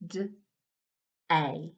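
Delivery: D and A as separate phonics sounds; sounding out words. Shown as a demonstration. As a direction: segment D then A slowly. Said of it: "One more time".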